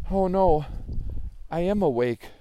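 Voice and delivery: loud, high dismay; dismayed realisation. Acted. Joey finds he is awake, dismayed. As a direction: exclaim it as the truth lands.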